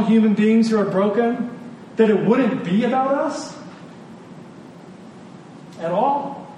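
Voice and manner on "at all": ordinary delivery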